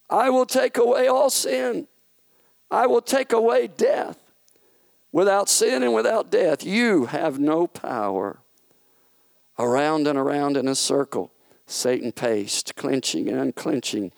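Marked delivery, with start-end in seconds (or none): 0.1-8.4 higher, louder declaration; triumphant declaration. Acted. God answering Satan's retort in triumph, louder and higher. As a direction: ring out each promise in triumph.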